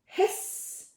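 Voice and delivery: voiceless goose hiss. Acted. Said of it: "said the goose".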